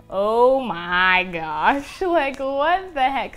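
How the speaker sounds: slow, drawn-out gasp of wonder, laughing at the end; amazed disbelief. Retold or imitated. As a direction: let the amazement stretch each word. Paint appears from an empty roller.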